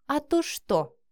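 high-pitched challenge; cheeky, provoking question; defiant. A narrator's reading. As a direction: throw it back as a dare. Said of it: "с вызовом спросила она".

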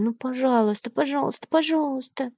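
put-on high, softening child begging; begging sweetly, fading away; pleading little girl. A narrator's reading. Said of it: "обратилась Лена к нему".

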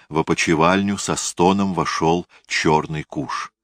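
usual speaking voice

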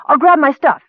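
high, loud, eager cry; excited shout. A narrator's reading. Kender off to pack for adventure, excited shout. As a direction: call out in a hurry to be off. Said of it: "shouted the kender".

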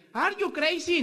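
imitating the startled mechanic; shocked question. Agency man shocked at mileage. (pregunta sorprendida, mucho más aguda)

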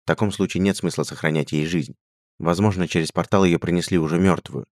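usual speaking voice

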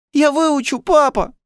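put-on very high, loud child's voice; pleading little boy. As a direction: promise desperately in a small boy's high voice. Tearful boy promises his father.

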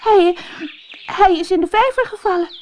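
put-on high, fast, stammering girl; upset princess. Princess explains to the frog, high and hurried. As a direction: rush it out like a frightened princess.

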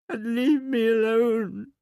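high, loud tearful wail; sobbing. A narrator's reading. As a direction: wail it out slowly through sobs. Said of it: "she sobbed".

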